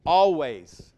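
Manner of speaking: Always punched and falling; emphatic always. Shown as a demonstration. Pastor stressing one word to the church, emphatic. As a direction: drive the word Always home. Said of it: "That word is emphatic".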